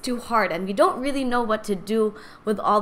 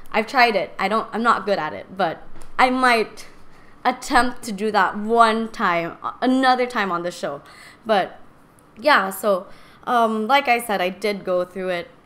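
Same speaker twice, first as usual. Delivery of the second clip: ordinary talk, nothing marked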